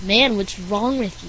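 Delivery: normal talking voice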